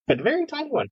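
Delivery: high tiny voice. Acted. GM describes tiny dragon.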